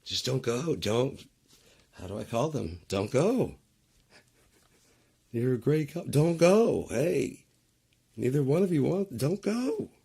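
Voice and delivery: high, loud, desperate begging; frantic pleading. Pretend. Viewer begging both characters not to leave, frantic and loud.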